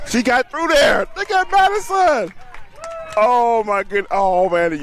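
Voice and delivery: high, loud cheering yell; real thrill, shout it out; excited shout. Real, not acted. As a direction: parent cheering a close play at third. Watching daughter's team score.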